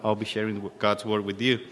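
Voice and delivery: normal talking voice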